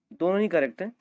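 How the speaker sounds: ordinary talk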